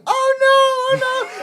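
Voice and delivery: put-on high, slow whiny baby "oh no"; mock baby wail. Babies in the scene, whining high and slow. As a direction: whimper like a fake-crying baby. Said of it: "babies are supposed to be like".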